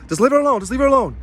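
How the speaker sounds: high, pressing repeated plea; urgent insistence. Retold or imitated. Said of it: "I'm just like".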